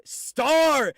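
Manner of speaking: high, loud shout; sudden loud cheer; excited call-out. Real, not acted. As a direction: holler the name in greeting. Calls out to a chatter.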